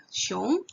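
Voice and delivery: Xiong rising upward at the end; second tone Xiong. Shown as a demonstration. Showing the class tone two on Xiong, rising. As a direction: lift Xiong like a question. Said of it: "Ini contohnya pakai nada dua ya".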